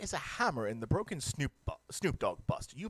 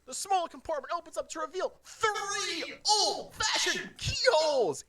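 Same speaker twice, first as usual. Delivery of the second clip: laughing; 4 dB louder than usual. Real, not acted. Laughing reading the reveal.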